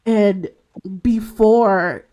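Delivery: normal talking voice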